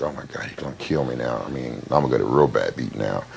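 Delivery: dreading dad's arrival, murmur it under your breath; scared thought. Retold or imitated. Boy in principal's office dreading his father, hushed fearful murmur. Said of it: "I'm like".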